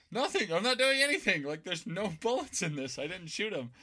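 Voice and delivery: high, loud frantic protest, easing down; panicked denial. Retold or imitated. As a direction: blurt out your innocence in a panic. Kid panicking, denying to dad.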